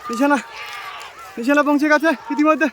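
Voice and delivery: high, loud excited race call; race caller. Acted. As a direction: ride the rising excitement of the race. Race starts, commentator excited. (উত্তেজিত ঘোড়দৌড়ের ধারাভাষ্য)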